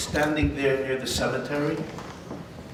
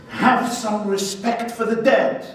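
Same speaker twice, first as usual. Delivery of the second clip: higher, slow, solemn rebuke; stern rabbi rebuke; 8 semitones higher, 3 dB louder, slower than usual. Acted. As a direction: scold him gravely, slowly.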